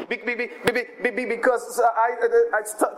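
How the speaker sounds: Moses stammering on be-, half acted; mock stutter. Moses pleading with God that he can't speak, lightly stuttering. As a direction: stutter through it, repeating the syllable.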